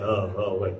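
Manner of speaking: low, soft, absent-minded oh oh wait; distracted brush-off. Dad waving off his child, low and distracted. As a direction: mumble it like a dad not listening.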